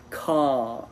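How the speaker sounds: car with the A drawn out; long vowel. Shown as a demonstration. Teacher giving an example to a learner, car with long vowel. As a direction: hold the open vowel in car. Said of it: "une voyelle longue que tu dois dire".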